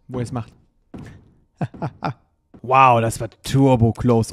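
ordinary talk, nothing marked

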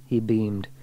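ordinary delivery, nothing marked

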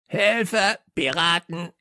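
high, squawking parrot voice. Acted. Parrot raising the alarm at visitors, high croaking squawk. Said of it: "krächzte Störtebeker".

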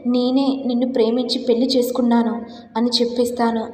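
ordinary delivery, nothing marked

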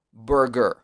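burger with a full English er; correct English pronunciation. Shown as a demonstration. Teacher modelling after the sound drill, English-sounding burger. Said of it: "en la parte baja de la boca".

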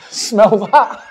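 high, loud retold prank line; laughing mock offer; laughing imitation. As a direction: act out the prankster while cracking up. Retold prank, told through laughter.

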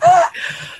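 sharp, high thrilled gasp of delight; a loud, breathless gasp of joy; excited gasp. Retold or imitated. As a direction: gasp like a starstruck fan. Fan thrilled at being named.

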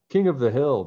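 loud crowing boast; boastful shout. Acted. Bradley boasting as he speeds downhill, loud. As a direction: show off at full speed. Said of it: "Bradley shouted as he gained speed".

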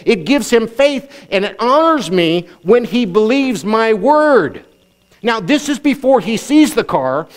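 high, emphatic pulpit delivery with stretched key words; fervent preaching. Acted. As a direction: drive the teaching home to a congregation. Preaches at heightened pitch.

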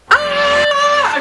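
shrill high scream of frustration; long, very high-pitched shriek. Real, not acted. Vents at bad lighting.

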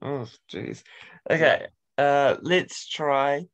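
normal talking voice

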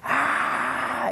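long airy "aah" with no voice; imitated crowd roar. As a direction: mimic a distant crowd roar on the breath. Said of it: "escucho cómo la gente grita". (imitando el rugido del público)

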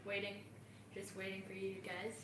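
usual speaking voice

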